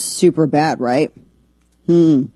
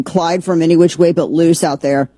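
normal talking voice

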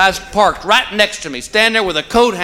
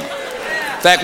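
normal talking voice

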